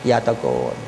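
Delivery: low, soft mock mooing of อย่าตะโกน; lightly hammed cow voice; mock cow bellow. Shown as a demonstration. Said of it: "แบบวัวร้อง".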